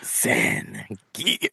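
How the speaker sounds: drawn-out character call of the name; Zangief shout-out. Pretend. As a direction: stretch the name out theatrically. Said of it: "have you ever done a Zangief".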